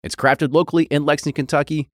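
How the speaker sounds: upbeat sponsor read. Acted. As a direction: read it like a commercial spot.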